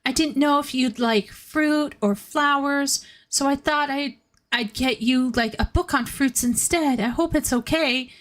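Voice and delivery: a light high nervous character voice; timid anime boy. Retold or imitated. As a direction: offer it shyly, hoping it's okay. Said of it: "he starts saying".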